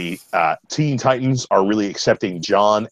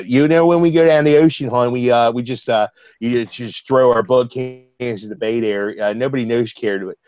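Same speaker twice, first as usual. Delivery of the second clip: Baltimore accent; 4 semitones higher than usual. Shown as a demonstration. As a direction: lay on the local Baltimore twang. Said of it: "I'll do a little, uh, Baltimore accent for you there".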